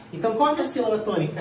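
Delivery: ordinary talk, nothing marked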